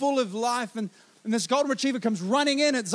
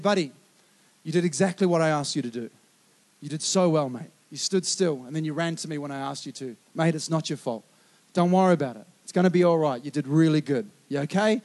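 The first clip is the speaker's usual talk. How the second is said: comforting son; 6 semitones lower than usual. Retold or imitated. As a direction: gently settle the upset boy. Father comforting upset son.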